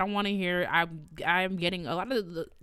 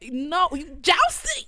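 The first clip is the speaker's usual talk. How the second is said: high, loud, drawn-out retort; slow, loud comic telling-off; exasperated scolding; 10 semitones higher, 7 dB louder, much slower than usual. Retold or imitated. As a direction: stretch it out in mock outrage. Acted scolding of jousting pupil.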